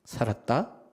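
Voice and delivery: lightly imitated Gyeongsang dialect, broken into short pieces. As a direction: answer like a Gyeongsang local. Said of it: "경상도 버전은 두 가지가 나오더라고요".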